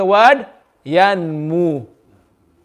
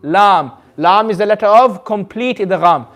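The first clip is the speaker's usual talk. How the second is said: ordinary delivery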